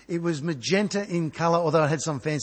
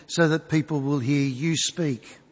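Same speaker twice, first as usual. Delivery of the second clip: earnest spoken prayer to the Father, slowing at the end; 4 semitones lower than usual. Acted. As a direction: pray it sincerely, letting it settle. Opening prayer before sermon.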